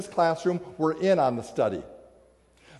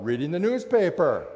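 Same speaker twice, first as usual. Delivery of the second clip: normal talking voice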